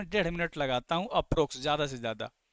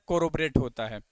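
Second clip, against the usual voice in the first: usual speaking voice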